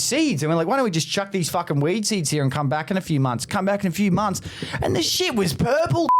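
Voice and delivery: high-pitched imitation of an old country bloke. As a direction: spin the yarn as a rough old country man, pitched high. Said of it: "Old country".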